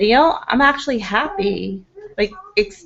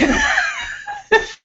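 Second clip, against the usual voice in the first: high squealing laugh. Real, not acted. Vlogger laughing at her own Russian accent, high squeal. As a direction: burst into a shrieking laugh.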